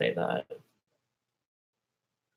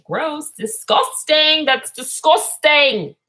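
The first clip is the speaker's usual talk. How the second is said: slow, loud, higher drawn-out disgust; 8 semitones higher, 11 dB louder, much slower than usual. Real, not acted. Reacting to Epstein with loud drawn-out disgust. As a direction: stretch out the disgust.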